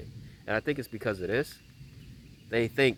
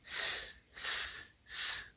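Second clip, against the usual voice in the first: imitated hiss; 6 dB quieter, much slower than usual. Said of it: "it just kept".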